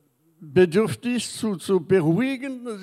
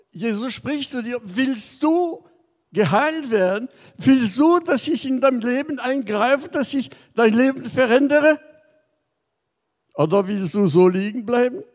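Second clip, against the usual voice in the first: preaching louder and higher, pressing the question; fervent preacher's challenge; rising sermon appeal; 5 semitones higher, 4 dB louder than usual. Acted. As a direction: urge the listener, building to a call. Preaching at full force.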